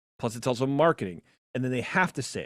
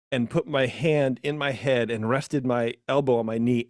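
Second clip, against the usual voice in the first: ordinary delivery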